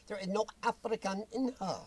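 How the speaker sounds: normal talking voice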